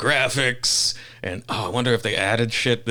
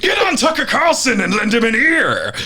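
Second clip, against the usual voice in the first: high shouted captain chant; bellowed parody chant; pirate captain; 11 semitones higher than usual. Pretend. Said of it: "parody of intro chant".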